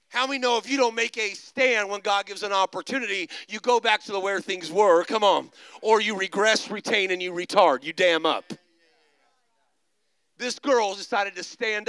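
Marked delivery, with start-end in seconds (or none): 0.1-8.5 louder, driving preacher's push; preaching. Acted. Preacher urges the congregation to take a stand, louder preaching. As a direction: press the point home to the room.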